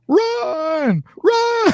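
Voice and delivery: high-pitched mock scream of panic; imitated scream. Retelling a beaten tackle's cry to Steve, mock screaming. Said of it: "started screaming".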